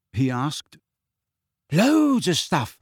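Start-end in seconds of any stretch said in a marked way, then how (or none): 1.7-2.8 high, stretched-out boast; proud. A narrator's reading. Neville boasts about what his plant does, proud. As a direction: show off with relish. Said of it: "said Neville proudly".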